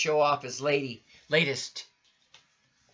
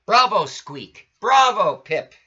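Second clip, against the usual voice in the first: much louder, higher cheer; audience shouting bravo; 7 semitones higher, 11 dB louder than usual. Acted. The audience cheering the brothers, loud shouted bravo. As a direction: call out bravo like a cheering crowd. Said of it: "the audience shouted".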